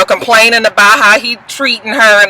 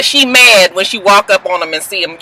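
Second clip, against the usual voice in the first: normal talking voice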